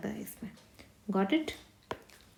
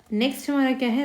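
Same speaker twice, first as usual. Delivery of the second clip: ordinary talk, nothing marked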